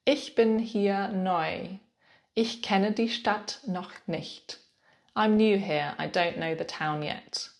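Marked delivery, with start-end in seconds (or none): 0.1-4.6 very slow, clear modelling; slow model phrase. Shown as a demonstration. Teacher slowly models the reply for new learners. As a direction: give each word room for the learner. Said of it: "you could respond by saying".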